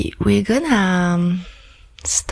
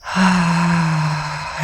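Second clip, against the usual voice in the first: long, airy exhale; breathing sigh. Shown as a demonstration. Coach showing listeners how to breathe, long sigh. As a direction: let out a long, slow breath.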